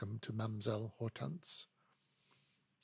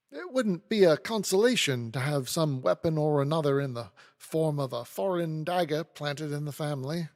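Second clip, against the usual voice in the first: louder, urgent entreaty; pleading; 3 semitones higher, 7 dB louder than usual. A narrator's reading. Snagsby begs the lawyer's sympathy, pleading. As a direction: press the plea politely but insistently. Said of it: "Mister Snagsby pleads".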